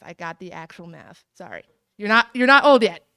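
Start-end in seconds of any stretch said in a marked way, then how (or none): 2.0-3.1 loud, playful jab at the honoree; teasing call-out. Real, not acted. Student teasing the honored teacher, loud playful jab. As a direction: tease him loudly, grinning.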